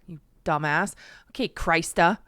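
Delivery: ordinary talk, nothing marked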